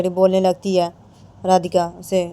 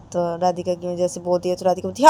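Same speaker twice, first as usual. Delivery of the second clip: ordinary delivery, nothing marked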